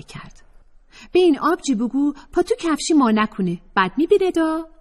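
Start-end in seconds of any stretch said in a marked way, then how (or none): 1.1-4.7 put-on high, fast, cheeky young woman; Atefeh's voice. Atefeh teasing the narrator, high and cheeky. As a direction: tease her sister-friend in a high voice. Atefeh warns via Fatemeh.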